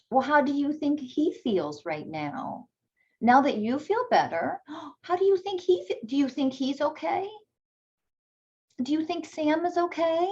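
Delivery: put-on high, bright coaxing voice to a toddler; sing-song parent voice. Shown as a demonstration.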